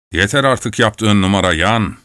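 ordinary delivery, nothing marked

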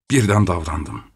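ordinary delivery

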